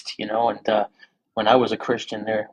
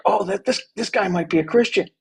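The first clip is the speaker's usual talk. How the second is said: higher-pitched retold giddiness; giddy; 7 semitones higher than usual. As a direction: play a mildly giddy, hopeful reaction. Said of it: "you get giddy".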